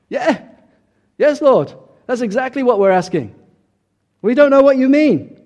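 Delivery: high, loud, pleading disciples' voice; confused disciples. Acted. Disciples answering Jesus, high and loud with confusion. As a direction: blurt it out as the baffled disciples.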